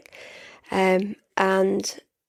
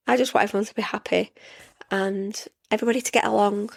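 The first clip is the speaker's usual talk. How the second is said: usual speaking voice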